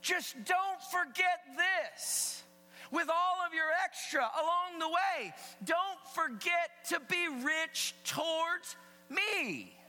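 high, forceful preacher speaking as God; emphatic divine rebuke, punching the key words; voicing God. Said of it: "He's saying this".

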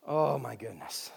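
ordinary delivery

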